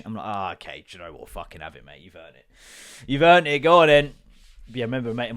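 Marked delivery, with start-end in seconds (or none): 0.3-2.5 low, soft mock surrender; resigned giving in. Gives in to brazen lie. 3.1-4.1 loud, hearty mock congratulation; emphatic concession.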